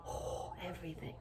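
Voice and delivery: a soft breathless whisper of wonder; awed whisper. Real, not acted. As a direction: let it fall away, whispered in awe. Dreaming of the ocean.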